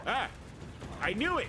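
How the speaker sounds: high, loud panicked yelp; startled shriek. Real, not acted. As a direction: shriek as the scare hits. Sudden attack in horror game.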